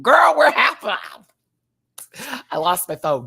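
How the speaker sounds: high, loud frantic shriek then settling; mock panic, high and loud; panicked shout. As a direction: yell it like you just lost your phone. Acted panicked quote on trip.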